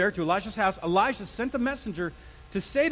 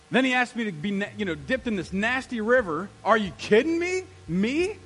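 incredulous indignation, rising in pitch; so-mad Naaman; 2 semitones higher, 5 dB louder than usual. Acted. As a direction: act insulted by a nasty request. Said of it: "He's so mad".

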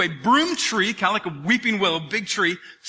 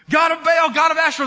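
loud, higher, pleading call to a god; 6 semitones higher, 5 dB louder than usual. Acted. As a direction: cry out to the heavens. Prophets calling to their god.

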